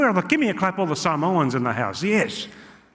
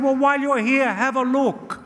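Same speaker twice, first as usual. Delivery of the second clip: put-on high, urging Italian-accented uncle; Gino; 9 semitones higher than usual. Uncle asking visiting nephew to check, high Italian accent. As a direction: imitate a pushy uncle, pitched up. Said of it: "He said".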